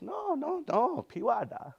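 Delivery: high, insistent no no before piwada; mock protest. Answering someone who says you're not thankful, high protesting no. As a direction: brush off the accusation with a squeaky denial. Protesting being called unthankful.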